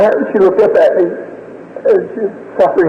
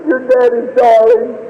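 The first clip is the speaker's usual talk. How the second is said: very high, tender grieving voice; tearful farewell; 6 semitones higher than usual. Retold or imitated. Father blessing his dying daughter, tearful. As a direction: bless the child through grief.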